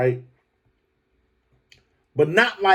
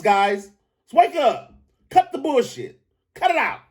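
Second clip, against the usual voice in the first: worked-up rant; 8 semitones higher than usual. Real, not acted. Ranting about fans deciding.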